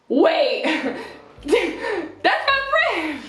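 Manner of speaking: high, drawn-out comic realization; hammed-up high-pitched alarm; mock shocked. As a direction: imitate a friend's dawning shock, squeaky and slow. Acts out shocked friend.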